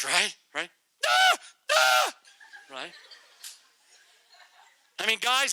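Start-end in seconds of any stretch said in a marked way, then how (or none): 1.1-2.1 high loud wail; shrill mock child wail; kid's cry. Shown as a demonstration. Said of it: "your unique cries".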